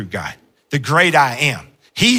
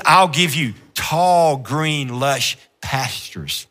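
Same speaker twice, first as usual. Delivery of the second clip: tender promise; 3 semitones lower, slower than usual. Acted. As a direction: lull them toward rest. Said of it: "he says".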